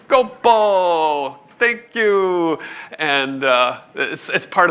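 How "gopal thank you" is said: higher, louder call ringing across the camp; ringing out a name. Retold or imitated. Expedition leader thanking the cook after dinner, loud ringing call. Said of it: "would ring out his name".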